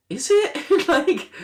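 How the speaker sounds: very high, loud squeak of doubt; mock incredulous. Sceptic answering gushing viewers of viral videos, mock incredulous. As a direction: squeal it in exaggerated disbelief.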